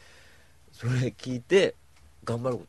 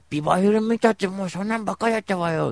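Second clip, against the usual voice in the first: camp friend voice; 9 semitones higher, 6 dB louder than usual. Retold or imitated. Voicing friend うーちゃん.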